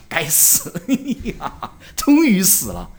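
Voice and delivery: slow, laughing mock delight at a death; gloating glee. Saul rejoicing over Stephen's death, slow laughing gloat. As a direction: sneer it out with a chuckle, savoring it. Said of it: "他是这种态度".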